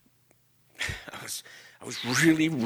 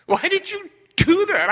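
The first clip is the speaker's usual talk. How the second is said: much higher, louder self-reproach; 4 dB louder than usual. Retold or imitated. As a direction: demand answers from yourself in disgust. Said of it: "I was furious with myself".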